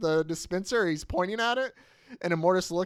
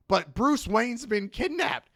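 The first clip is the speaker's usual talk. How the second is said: high, drawn-out acted protest; urgent plea; 8 semitones higher, slower than usual. Peacemaker begs his boss for help, urgently. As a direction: plead it slowly in a raised voice. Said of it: "And he goes".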